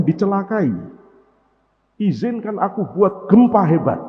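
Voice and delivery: loud, high protest to God; the earth shouting it will not accept this; earth crying out. Acted. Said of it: "Bumi ini teriak ke Allah".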